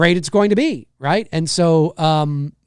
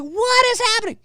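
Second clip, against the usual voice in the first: put-on very high confused child; squeaky bewildered question; baffled kid voice. Streamer as a puzzled six-year-old, very high. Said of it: "I'm like".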